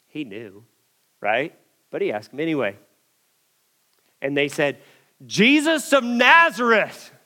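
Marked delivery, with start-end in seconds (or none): 5.3-7.1 loud, slow, bold declaration; big, tough, high-raised answer; confident soldiers. Acted. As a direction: boom it out with full confidence. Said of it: "with a little shakier voice than the first time".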